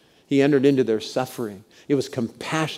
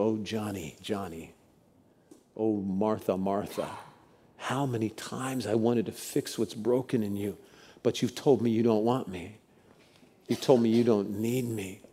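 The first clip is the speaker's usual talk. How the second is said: tender plea; 3 semitones lower, 7 dB quieter than usual. Acted. Jesus pleading over listeners.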